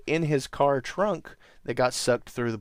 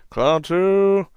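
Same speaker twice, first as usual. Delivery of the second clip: loud, high theatrical call; loud proclamation; 7 semitones higher, 9 dB louder than usual. Retold or imitated. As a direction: belt out the magic word. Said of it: "his bright idea is to go".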